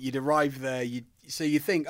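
normal talking voice

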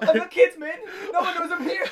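very high, whimpering through laughter; hammed-up wailing for mercy; mock tearful plea. Doomed man pleading for his kids, mock wail.

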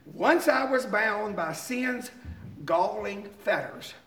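slow, measured hymn recital; slow reading. Real, not acted. Preacher reads a beloved hymn verse to congregation, slowly. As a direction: let each phrase of the verse soak in. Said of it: "I'm just gonna read them slowly".

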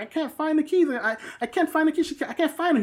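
high-pitched frantic mock girlfriend; panicked mimicry. Panicked girlfriend voiced in retelling.

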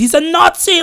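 high, frantic accusing cry; panicked cry. Retold or imitated. Survivor screams out the Nazi at the barbecue, high panicked cry. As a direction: shriek the accusation in terror. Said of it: "just starting to go crazy".

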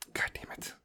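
a whispered, annoyed God damn it; whispered curse. Real, not acted. Podcaster catching his own slip, whispered curse. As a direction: curse at yourself under your breath in a whisper.

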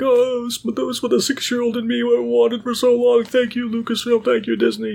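high, gushing mock-crying fan voice; hammed-up tearful gushing; mock teary fan. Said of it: "apparently he's crying".

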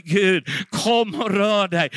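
a slow, pleading call to God; slow fervent prayer. Real, not acted. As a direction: draw each word out as a heartfelt plea. Preacher prays aloud in sermon.

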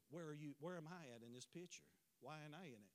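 low, very soft fatherly question; gentle hurt question. Retold or imitated. Father asking daughter why he is missing from her picture, gentle hurt question. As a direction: drop down low and soft, almost fading away.

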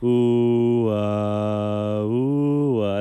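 loud, breathy-sounding ooh and ah, put on; mock dreamy oohs. Imitating an Instagram-versus-reality TikTok sound, loud ooh and ah. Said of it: "with the sound effect".

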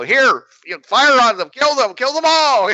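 high, rising shouted orders; Martian commander. Retold or imitated. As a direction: urge them to open fire. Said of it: "they'd be like".

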